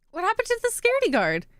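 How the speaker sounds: very high, quick character voice; high-voiced game character. Reading a character's question about the security guard, high quick voice. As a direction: pitch it way up and hurry the question.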